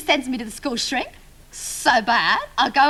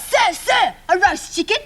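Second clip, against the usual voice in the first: higher-pitched, eager classroom answer; eager pupil; 5 semitones higher, slower than usual. Acted. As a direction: call for the teacher's attention, then answer.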